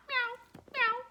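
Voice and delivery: high meows from a dog; dog running round meowing like a cat. A narrator's reading. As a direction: mew like a little cat. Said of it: "meowing like a cat".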